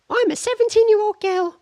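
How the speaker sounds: put-on high squeaky girl voice. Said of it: "Guess my voice".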